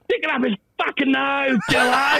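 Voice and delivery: high shouted Aussie sledge; raucous Aussie fielder yelling. Retold or imitated. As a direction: yell it from the slips. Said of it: "the guy at second slip just going".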